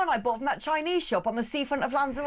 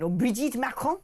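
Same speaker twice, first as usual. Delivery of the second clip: put-on French accent. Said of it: "you can say".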